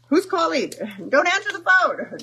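sudden high loud mock panic; playful fake alarm over a ringing phone. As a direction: pretend the phone call is a threat.

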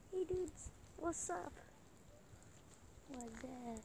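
high, very soft whisper. Real, not acted. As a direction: whisper a greeting to the reindeer. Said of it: "I have to whisper".

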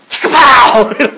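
slow, loud mock whip crack; a playful shouted sound effect. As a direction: snap it out like a lion tamer's whip. Lion tamer whip crack joke.

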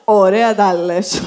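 low, slow, mock old-lady approval; lightly voiced elderly fan; old lady praise. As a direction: drag it out like a grumpy old lady. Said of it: "μου λένε αυτό το".

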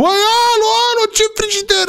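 very high-pitched squeal of protest; outraged shriek. Acted. Shopper objecting to the fridge purchase, shrieking in outrage. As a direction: shriek it in shocked disbelief.

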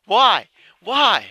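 loud, high wailed why; exasperated lament. Real, not acted. Lost composure, bemoans laughing.

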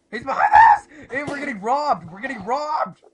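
high, very loud alarm; panicked yelling. Real, not acted. Player warns teammates of ambush, panicked yell. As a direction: shriek a warning to your teammates.

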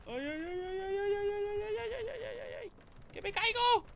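high panicked ay-ay screams; screaming while falling. Real, not acted. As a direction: shriek as you slip and fall. Slipping off a ledge.

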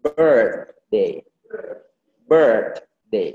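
"birthday" low, with r-coloured BIRTH, pause, day; BIRRTH... day; correct birthday. Shown as a demonstration. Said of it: "ที่จริงคำนี้มันอ่านว่า".

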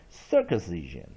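"Circumcision" loud with stress on "cir" and "si"; teacher stress demo; double stress. Shown as a demonstration. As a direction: punch both stressed syllables of "Circumcision". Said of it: "所以双重音circumcision".

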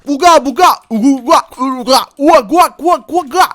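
loud mock caveman grunts; imitating caveman talk. Player tries talking to cavemen in their grunts. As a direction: try speaking their grunt language. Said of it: "konuşayım hatta".

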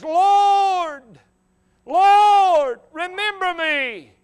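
high, loud, long "Lord", easing on the plea; cry for mercy. Acted. Thief's first words to Jesus, crying out. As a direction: cry it out from the pulpit. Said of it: "there was an open cry for mercy".

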